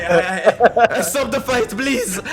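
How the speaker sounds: high, hammed-up begging; pained pleading. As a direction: plead loudly like a wounded fighter. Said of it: "He is screaming for pain".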